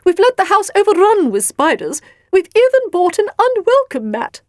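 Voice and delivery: high, loud complaining story character; exasperated character voice. A narrator's reading. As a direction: play the fretful host, pitched high and loud.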